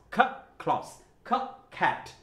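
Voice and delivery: isolated খ, a beat, then the word; aspirated K drill. Shown as a demonstration. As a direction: sound out খ before ক্লাস and cat. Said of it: "মনে থাকবে?".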